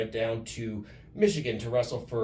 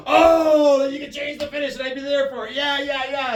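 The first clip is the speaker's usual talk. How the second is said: sudden realization; 9 dB louder than usual. Retold or imitated. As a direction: act out the penny dropping, loudly. Acted sudden realization, laughing.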